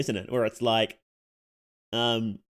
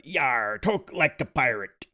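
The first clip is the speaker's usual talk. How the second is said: pirate talk; much slower than usual. Pretend.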